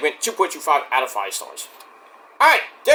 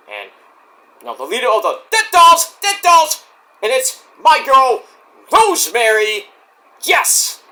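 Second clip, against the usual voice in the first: excited ring intro; 4 semitones higher than usual. Real, not acted. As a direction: hype her entrance like a ring announcer. Hyping her favourite wrestler's entrance.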